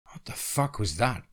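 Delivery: a hushed, angry hiss; a mild hissed demand. A narrator's reading. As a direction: snap it under your breath. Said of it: "I hissed".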